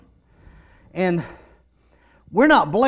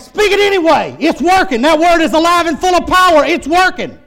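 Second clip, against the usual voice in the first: high, loud, insistent preaching; fervent pulpit exhortation; full-force preaching; 10 semitones higher, 8 dB louder than usual. Real, not acted. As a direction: shout down the doubt with conviction.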